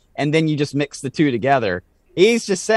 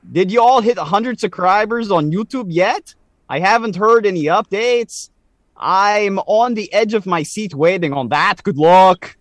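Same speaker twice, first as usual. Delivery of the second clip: higher, mock Canadian accent; 6 semitones higher, 3 dB louder than usual. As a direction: keep up the shaky Canadian accent. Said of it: "I'm gonna read this in a Canadian accent".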